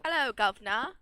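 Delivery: loud, hammed-up English accent greeting; put-on English accent, over the top; exaggerated English accent. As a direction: belt out a cartoonish English hello. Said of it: "the English accent is so easy to slip into".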